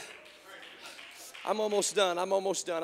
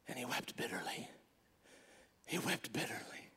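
hushed whisper; 5 semitones lower, 13 dB quieter than usual. A narrator's reading. Hushed telling of weeping.

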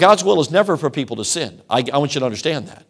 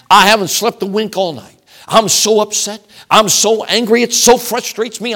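mock outrage; 6 semitones higher, 5 dB louder than usual. Congregant's mock outraged phone call.

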